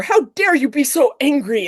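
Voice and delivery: hammed-up offended scholar; mock indignant man. Cole scolding Christine, mock indignant and high. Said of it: "he writes back almost immediately saying".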